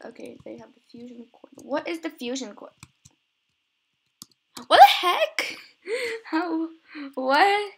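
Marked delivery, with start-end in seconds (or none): 4.7-7.7 high, loud burst of disbelief; astonished. Real, not acted. Player confused by the fusion core, loudly astonished. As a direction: exclaim with laughing bewilderment. Confused by what just happened.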